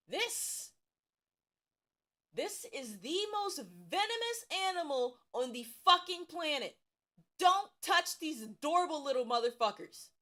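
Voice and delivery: high urgent warning, almost yelling; alarmed warning. Real, not acted. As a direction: warn them like it's life or death.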